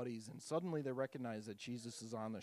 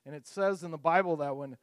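ordinary talk

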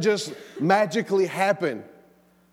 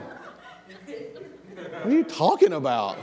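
laughing; much slower than usual. Real, not acted. Laughing at son's random question.

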